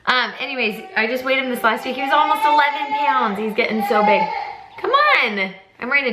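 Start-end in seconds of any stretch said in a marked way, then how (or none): 4.8-5.5 high, brief coaxing call; higher-pitched parent's call. Real, not acted. Calling the little girl over.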